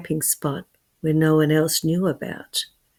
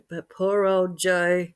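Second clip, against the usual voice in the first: ordinary delivery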